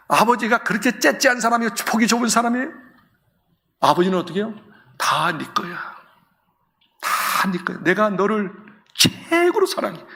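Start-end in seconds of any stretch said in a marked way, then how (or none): 5.0-10.0 tender father. Acted.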